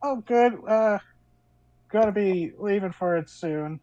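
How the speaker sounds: a hint of a cheerful daughter; breezy teen before school; daughter's voice. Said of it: "your daughter".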